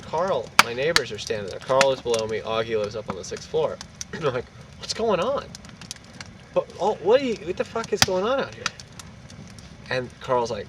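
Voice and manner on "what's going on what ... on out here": high-pitched baffled questioning; retold bewilderment. Confused tenant asking neighbors in the hall, baffled.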